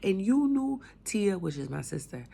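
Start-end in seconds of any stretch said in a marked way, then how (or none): 0.0-0.8 mother's angry accusation. Retold or imitated. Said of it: "kicked in the door".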